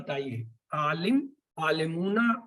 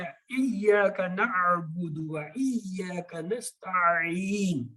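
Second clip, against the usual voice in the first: drawn-out recitation; much slower than usual. Shown as a demonstration. Teacher recites verse drawn out.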